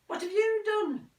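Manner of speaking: high, slow and probing; mother's voice. Acted. Mother checking on son, high and slow. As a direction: lift the voice into the mother asking.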